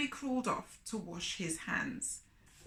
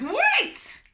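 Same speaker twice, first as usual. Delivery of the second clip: much higher and louder sharp cry; cried out; 9 dB louder than usual. A narrator's reading. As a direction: stop him with a sudden shout. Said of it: "cried Anansi".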